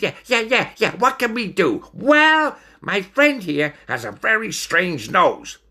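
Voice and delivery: high-pitched excitable chatter; eager. Acted. Stoat pitching the friends' talents to the ringmaster, high and eager. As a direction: gabble eagerly up high, keen to impress.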